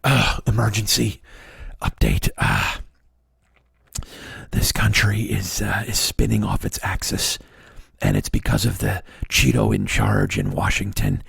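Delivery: low, heavy mock-solemn pundit; mock Michael Moore. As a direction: parody a somber pundit's urgent update. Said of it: "that Michael Moore bullshit where he's like".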